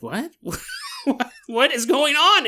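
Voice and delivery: laughing, high shocked what; bewildered outburst; startled disbelief. Retold or imitated. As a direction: Natalie hearing the voice, startled.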